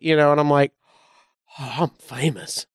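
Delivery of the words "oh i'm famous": soft, lower mock-awed murmur; hushed awe. Newcomer seeing his name at the gate, hushed awe. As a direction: breathe it out quietly, in wonder.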